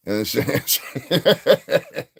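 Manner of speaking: slow words through laughter; laughing. Real, not acted. Streamer cracking up at his joke, laughing. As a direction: laugh as you say it.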